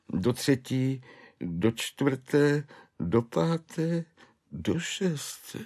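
high, wailing clerk's voice; a broken man recounting his spree; desperate clerk. Acted. Clerk tells Švejk how the name-day spree began, high wailing.

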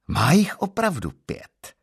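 high, loud and slow, overjoyed; a man thrilled to find five legs; joyful exclamation. Acted. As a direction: cry it out in delight at the count. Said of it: "zvolal jsem po chvíli radostně".

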